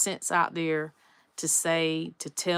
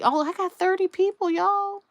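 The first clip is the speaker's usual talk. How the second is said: high, louder, delighted announcement; real excitement; 13 semitones higher, 5 dB louder than usual. Real, not acted. Vlogger spots thirty viewers on the live stream, excited. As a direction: burst out with sudden happy surprise.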